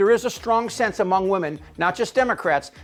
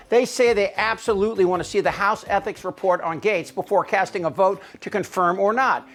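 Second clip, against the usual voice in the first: normal talking voice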